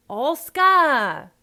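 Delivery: loud, high, drawn-out "ask a"; dropped H. Shown as a demonstration. Coach calling out the Cockney line, dropped H. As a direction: holler ASK A with no h. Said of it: "a beginning H is dropped".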